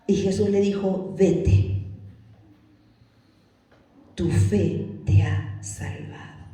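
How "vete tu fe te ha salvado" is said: slow, low, spaced words; solemn pronouncement. Acted. Jesus sending the healed man off, slow solemn assurance. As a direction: pronounce it slowly, with weight.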